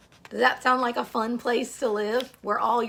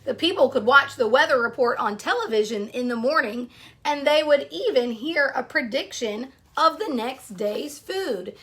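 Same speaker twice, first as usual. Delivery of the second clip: storybook read-aloud to a class; story narration; 2 semitones higher, 4 dB louder than usual. Acted. Teacher reading a picture book to her class, storytelling voice.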